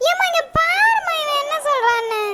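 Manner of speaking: put-on high, slow childish whine to mother; whiny little girl. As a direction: tattle to mom like a small girl. Little girl complains about brother.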